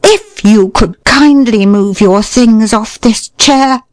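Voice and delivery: each word a loaded threat; venomous courtesy, drawn out slowly; icy menace. A narrator's reading. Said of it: "in a voice that would blow brains out".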